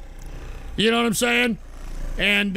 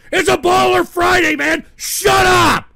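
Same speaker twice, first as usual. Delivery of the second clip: much higher and louder angry yell; angry shout; 9 semitones higher, 7 dB louder than usual. Real, not acted. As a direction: blow up at the trolls. Shouting at the chat.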